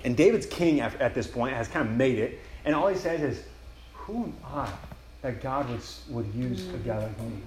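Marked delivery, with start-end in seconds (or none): 3.9-7.4 softer, slow, awed question; barely believing he is chosen; humbled wonder. Acted. David to God, asking who he is to be blessed, hushed.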